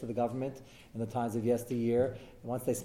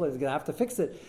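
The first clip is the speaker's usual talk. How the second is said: ordinary talk, nothing marked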